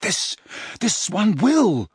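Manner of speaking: halting, high, frantic promise; slow, strained reassurance; gasping. A narrator's reading. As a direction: gasp it out, restarting the sentence. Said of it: "gasped Harry".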